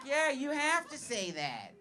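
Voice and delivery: high, slow, drawn-out protest; mock exasperated retort. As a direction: whine back at a relative, hammed-up. Comic acts retort to uncle.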